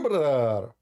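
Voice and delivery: ordinary delivery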